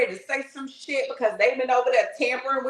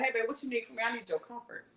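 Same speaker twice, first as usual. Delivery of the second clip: soft God voice; 11 dB quieter, faster than usual. Acted. God answering a scared believer.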